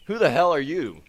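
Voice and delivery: sudden loud startled demand; startled shout. Acted. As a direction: shout it, caught off guard. Startled by stranger appearing.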